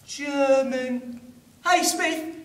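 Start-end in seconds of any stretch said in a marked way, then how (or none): none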